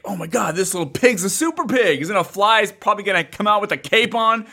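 high, eager retelling of the hype; building up the super pig with glee; mock excited. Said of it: "And I was like".

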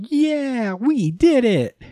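high, drawn-out mock cheer; mock celebration. Podcaster voicing kids who escaped unharmed, mock celebration.

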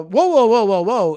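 booming run of protests; loud interruption. Retold or imitated. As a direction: imitate barging in to halt someone. Retells himself cutting off the telemarketer.

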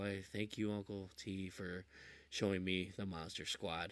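mock devout thanks; hushed thanks. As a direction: pray it like a grateful ritual. Daily thanks to Uncle T.

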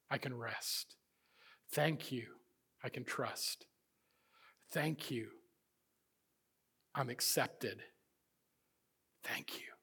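soft, hushed thanks, almost breathless; grateful whisper. Acted. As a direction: whisper it like a private prayer.

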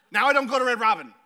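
ordinary talk

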